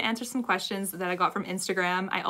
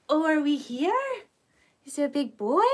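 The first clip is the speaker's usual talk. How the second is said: greeting the dog; 6 semitones higher, 4 dB louder than usual. Real, not acted. Greeting dog in front of camera.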